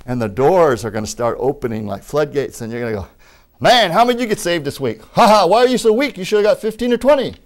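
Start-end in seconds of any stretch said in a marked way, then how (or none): 3.6-7.3 loud, higher-pitched boisterous teasing; mock taunting. Preacher ribbing someone about people saved, loud playful taunt. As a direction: crow over a friend's poor score.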